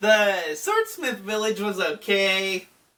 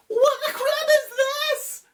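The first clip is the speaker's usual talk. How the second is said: high squealing panic, way up in pitch; shocked squeal. Retold or imitated. A character falling yells in shock. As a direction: yelp it like a kid in a sudden drop.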